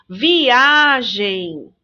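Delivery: "Viagem" slow, with a long stressed A; teacher's drawn-out vi-AAA-gem; stressed syllable. Shown as a demonstration. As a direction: lean hard on the A in "Viagem". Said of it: "Olha quem se destaca".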